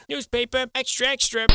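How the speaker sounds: put-on high, loud old-time newsboy cry; newsboy call. Newsboy calling to passersby, high loud cry. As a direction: hawk papers on a street corner.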